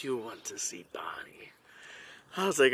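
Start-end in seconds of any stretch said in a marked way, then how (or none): 0.0-1.5 low, hushed, conspiratorial whisper; whispered imitation. Camp kid asking him about the horse, whispered imitation.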